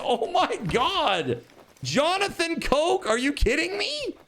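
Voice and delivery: high, slow, amazed; astonished and laughing. Real, not acted. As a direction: drag it out in giddy disbelief. Reacting to huge donation.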